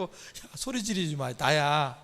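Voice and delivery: soft, slow, hushed voice; low-key and calming, well below full voice; quiet reassurance, retold. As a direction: voice Jesus gently shushing the disciples. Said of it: "이렇게 얘기했어요".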